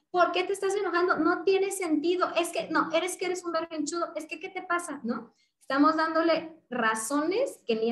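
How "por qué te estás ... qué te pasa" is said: high-pitched scolding parent; exasperated parent. Retold or imitated. Parent lecturing a tantruming child, high exasperated scolding. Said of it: "Cuando yo le doy por razones".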